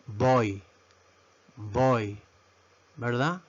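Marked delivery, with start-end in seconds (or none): 0.1-2.2 put-on boy said slowly and low; boy as a careful, lower-pitched teaching word; English word demo. Shown as a demonstration. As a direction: model boy as one clear syllable. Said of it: "que se pronuncia boy".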